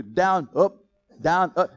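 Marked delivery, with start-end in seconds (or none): none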